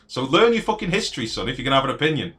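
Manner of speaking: high, loud scolding, fast at the end; angry shout. Real, not acted. Telling chatter Shivan to learn history, angry loud shout. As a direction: bark the lecture at him.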